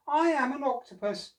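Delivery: very high, loud friendly creature; octopus voice. Acted. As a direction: greet Oscar cheerfully as the octopus. Octopus speaks.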